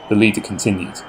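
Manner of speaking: plain reading voice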